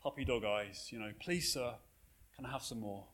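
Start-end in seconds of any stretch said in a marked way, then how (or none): 1.2-3.0 very quiet, low timid plea. Acted. Timid Oliver begging the master for food, very quiet and meek. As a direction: ask softly and meekly, trailing lower. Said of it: "in a very quiet, timid voice".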